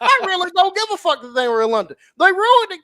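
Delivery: heated rant. Real, not acted. Podcast host fuming over a title change, loud and exasperated. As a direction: vent the frustration hard. Ranting about Saraya title win.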